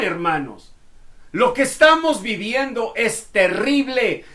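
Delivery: loud, high, fervent preaching; preacher at full force. Acted. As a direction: proclaim it loud to the congregation.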